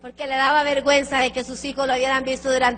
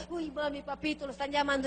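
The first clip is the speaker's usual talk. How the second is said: hushed warning; 11 dB quieter, faster than usual. Retold or imitated. Kids hushed warning about phone.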